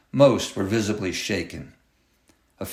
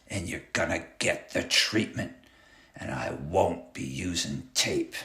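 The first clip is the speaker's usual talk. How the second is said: low menace; 3 semitones lower, 7 dB quieter than usual. A narrator's reading. Clown's quiet menace.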